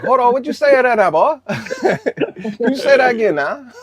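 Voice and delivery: loud, very high-pitched movie-character voice; Bobby Boucher. Acted. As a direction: squeal it out high and loud, laughing. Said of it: "Be like, Bobby Boucher".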